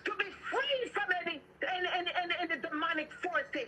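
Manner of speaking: high, insistent, repetitive prayer cadence; fervent deliverance prayer. Real, not acted. Preacher praying listeners free from bondage, fervent deliverance. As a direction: push each plea out with urgency.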